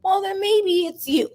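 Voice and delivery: high mock accusing voice; imitating people blaming her; mocking accuser. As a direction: mimic the accuser, high and snide. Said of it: "then people say".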